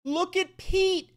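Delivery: high, squealed incredulous jeer; jeering outburst. Real, not acted. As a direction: squeal at the screen in disbelief. Jeering at the clip.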